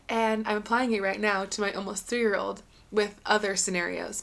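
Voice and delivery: ordinary delivery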